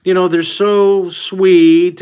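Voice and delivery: slow, drawn-out sugary voice; mock gushing. Mocking what everybody says about dolphins, slow gushing. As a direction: parody the people who adore dolphins. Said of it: "how everybody always says".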